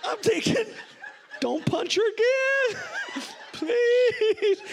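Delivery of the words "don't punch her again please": very high, drawn-out comic plea; panicked begging. Retold or imitated. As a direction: beg in a squealed, half-laughing mock panic. Acted panicked plea in story.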